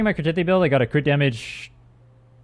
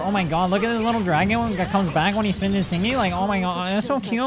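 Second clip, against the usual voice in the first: mock gushing fan; 6 semitones higher than usual.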